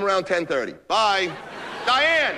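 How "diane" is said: loud, high summoning shout; calling out. Acted. Boss summoning Diane after hanging up, calling out sharply. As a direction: yell for your assistant.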